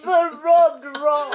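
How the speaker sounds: loud, high, drawn-out mock wail; suffering Southerner. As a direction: lament slowly and melodramatically. Playing weary Southern gas pumpers.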